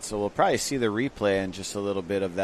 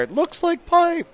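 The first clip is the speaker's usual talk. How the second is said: a much higher, hammed-up voice, jokingly mimicking East Coast surfers; mock surfer voice; 3 dB louder than usual. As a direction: tease them by quoting them in a falsetto.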